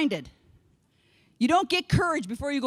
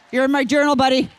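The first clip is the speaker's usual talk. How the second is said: louder playful callout; calling out; 9 dB louder than usual. Real, not acted. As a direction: call it out to him across the room. Calling out to audience member.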